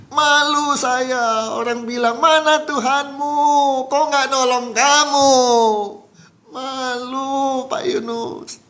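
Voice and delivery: high, drawn-out imitated lament; retold tearful wail. Victim telling Pak Yunus how people mock him, retold tearful wail. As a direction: wail it slowly as the shamed victim. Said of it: "sambil nangis".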